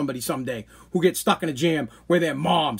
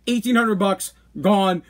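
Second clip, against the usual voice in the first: ordinary delivery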